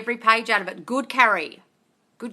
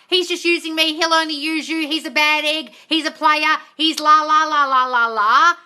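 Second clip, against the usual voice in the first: mock quote; 5 semitones higher, 5 dB louder than usual. Mocking the fears in her head.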